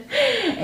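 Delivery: high embarrassed laugh through tears; little tearful giggle; teary laugh. Real, not acted. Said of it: "I've been crying".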